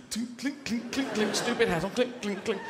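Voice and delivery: soft high clink sounds; imitated spurs clinking. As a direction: mimic jingling spurs.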